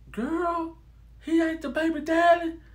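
high-pitched mock mama voice; outraged mother. Her mama reading the paper, outraged high voice. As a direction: imitate a mother exclaiming way up high.

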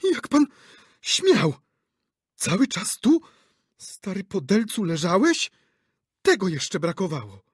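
high, loud outraged tirade; furious scolding. A narrator's reading. Actress to old director, furious scolding. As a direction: tear into him.

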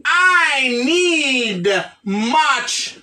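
very slow, drawn-out "I need much"; slow modelling. Shown as a demonstration. Teacher giving the class the correct English phrase, slowly modelled. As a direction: stretch "I", "need" and "much" for learners.

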